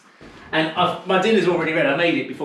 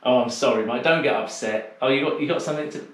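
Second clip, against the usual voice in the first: ordinary delivery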